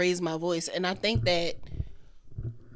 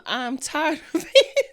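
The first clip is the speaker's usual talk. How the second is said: laughing; 9 semitones higher, 6 dB louder, slower than usual. Real, not acted. Laughing mock exasperation.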